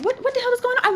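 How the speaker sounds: high, incredulous question; bewildered high-voiced reaction; retold confusion. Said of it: "So I'm over here confused".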